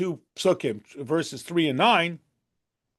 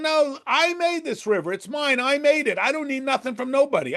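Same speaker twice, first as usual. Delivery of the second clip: a lightly voiced boastful Pharaoh, pitched higher; Pharaoh's denial; 7 semitones higher than usual. Pharaoh boasting to Egypt he made the river, lightly voiced proud tone. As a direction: play Pharaoh insisting he needs nobody, defiant and proud. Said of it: "So they pretend".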